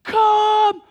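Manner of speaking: long, high sustained scream; full-lung war cry scream. Retold or imitated. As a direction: scream it out, holding each word. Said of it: "screamed at the top of their lungs".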